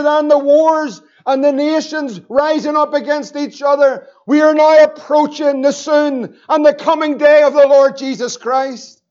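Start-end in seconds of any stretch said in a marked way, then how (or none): none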